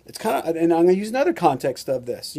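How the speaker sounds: normal talking voice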